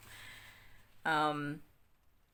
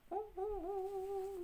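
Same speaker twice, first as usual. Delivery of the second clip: delighted squeal; 14 semitones higher, 4 dB quieter than usual. Real, not acted. Delighted by the fabric.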